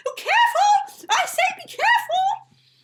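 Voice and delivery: very high, loud fussy squeal; panicked squeal. Acted. Shop owner squeals at clumsy customer. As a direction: squeal in alarm at the shaking rails. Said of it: "Missus Poddlebottom squealed".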